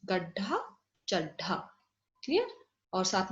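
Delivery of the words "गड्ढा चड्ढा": गड्ढा and चड्ढा with the retroflex ढ held; teacher models the doubled ड; model pronunciation. Shown as a demonstration. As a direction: say गड्ढा, चड्ढा clearly for copying. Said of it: "कैसे pronounce करना है".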